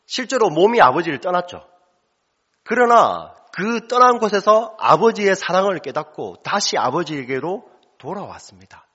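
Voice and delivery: impassioned sermon climax; fervent preaching. Real, not acted. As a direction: drive the point home to the congregation. Preaching at full force.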